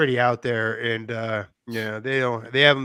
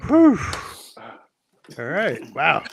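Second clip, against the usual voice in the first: after laughing hard; slower than usual. Real, not acted. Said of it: "my face hurts from laughing".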